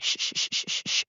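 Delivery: whispered shh shh to silence someone; shushing. Retold or imitated. People tuning out screaming protesters, soft shushing. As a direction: hiss them quiet with repeated shushes. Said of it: "They're just like".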